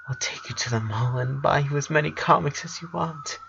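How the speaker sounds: interrupting sister. A narrator's reading. Sister cutting in on her brother, softly tearful. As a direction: cut in gently through tears. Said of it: "she interrupted him".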